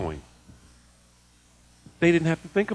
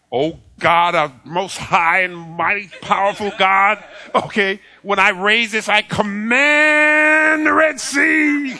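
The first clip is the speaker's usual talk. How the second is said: mock grandiose command; 4 semitones higher, 6 dB louder, slower than usual. Mock grand prayer of Moses.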